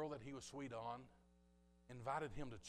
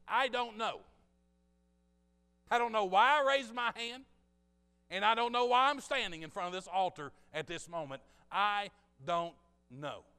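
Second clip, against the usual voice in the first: emphatic confession; 8 semitones higher, 14 dB louder than usual. Retold or imitated. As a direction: hammer out the bewilderment at full force. Retold confession at the altar.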